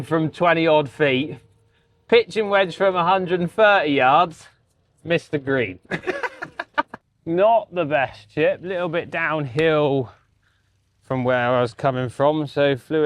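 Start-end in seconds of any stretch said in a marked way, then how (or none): 5.8-6.9 short real laugh. Real, not acted. Laughs at own missed green.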